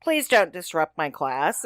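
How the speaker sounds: ordinary delivery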